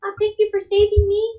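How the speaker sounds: put-on high, grateful little-girl voice; childlike high pitch. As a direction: thank them like a small child. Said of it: "the little girl kinda".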